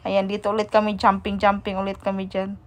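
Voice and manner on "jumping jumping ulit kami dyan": ordinary delivery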